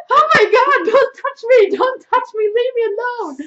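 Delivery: very high, loud hammed-up screaming; mock panicked shriek. Woman grabbed in a club, mock panicked shriek. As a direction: shriek to fend someone off.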